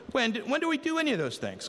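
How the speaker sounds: high, quick, baffled question; surprised righteous. Acted. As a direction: act out the puzzled righteous asking when. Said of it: "'Cause they were surprised".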